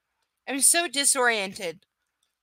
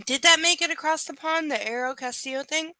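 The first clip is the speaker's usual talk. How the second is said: louder and higher, calling across; 8 semitones higher, 6 dB louder than usual. Real, not acted. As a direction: call to him in the next room. Calling out to Mark.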